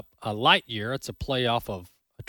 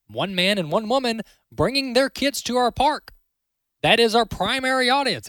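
defiant CEO; 7 semitones higher than usual. Pretend. Imagined CEO tossing press releases, defiant and emphatic. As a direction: speak as a CEO flatly refusing the woke line. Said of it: "Just be like".

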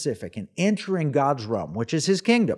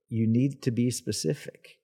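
hushed, softer quoted counsel; quiet, measured instruction; Holy Spirit; 5 dB quieter than usual. Retold or imitated. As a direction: drop the volume for a divine word. Retold word from Holy Spirit.

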